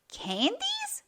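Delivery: high, outraged squeal of dismay; disappointed cry. A narrator's reading. Disappointed witch crying out at the sweets. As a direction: shriek it like a let-down child. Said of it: "cried the disappointed little witch".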